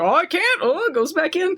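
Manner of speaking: high startled squeak; surprised. Retold or imitated. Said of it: "he's like".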